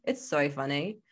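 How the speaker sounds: "so" turned into Aussie "soy"; mock-Australian, vowel in "so" bent to "soy"; fake Aussie accent. Shown as a demonstration. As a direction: do a jokey Australian "so". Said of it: "a fake Aussie accent when we say the word so".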